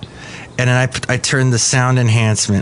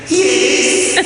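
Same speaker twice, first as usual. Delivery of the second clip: very high comic character voice; Madame Rutabaga; 13 semitones higher than usual. Acted. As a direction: answer in a squeaky falsetto. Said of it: "Do you love it".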